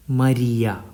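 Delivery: long ee in the middle of "Maria"; Italian pronunciation. Shown as a demonstration. Teacher giving an example of Italian I, "Maria" as mah-REE-ah. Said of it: "Note the pronunciation of the word".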